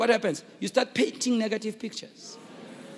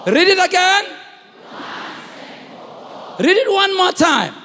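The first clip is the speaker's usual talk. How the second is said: high, loud urging call; rally the room to read along; preaching at full force; 9 semitones higher, 6 dB louder, slower than usual. Acted. Pastor urging congregation to read, high loud call.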